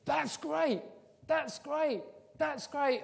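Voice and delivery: high, loud delighted praise; enthusiastic marker. Acted. Teacher highlighting a pupil's work, loudly enthusiastic. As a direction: exclaim it with soaring approval. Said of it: "you go with a highlighter pen".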